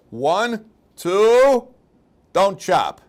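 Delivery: rising count ending in a loud shout; dramatic countdown, last word shouted high; executioner's countdown. Acted. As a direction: build the count up, then yell the stop.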